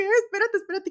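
high, loud telling-off; stern guard. Retold or imitated. Female security guard stopping a fan, high loud order. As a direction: stop her firmly. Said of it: "la señora así de que".

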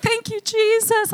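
high, slow, drawn-out thanks; praising. Retold or imitated. Speaker reliving her prayer after laughing, praising. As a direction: lift the voice in praise. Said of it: "I started praising him".

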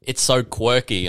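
high airy mock voice with a giggle; mocking quirky faker. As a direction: play the cutesy faker bragging.

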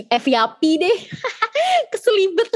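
high giggling through stumbled words; laughing fit. Real, not acted. Speaker laughing at tripping over FYP, high giggles. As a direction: break up laughing over the slip.

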